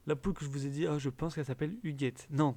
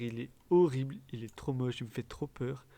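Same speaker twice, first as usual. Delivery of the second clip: ordinary delivery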